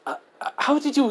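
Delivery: high-pitched, stammering disbelief; stunned stammer. Acted. Girl baffled that he crushed her remote, stunned stammer. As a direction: stumble into a baffled question.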